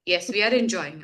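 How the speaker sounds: low, unenthusiastic imitation; unexpressive reply. Vietnamese people insisting they are enjoying, unexpressive. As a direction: mimic someone claiming enjoyment without showing any. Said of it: "not able to comprehend that you're enjoying".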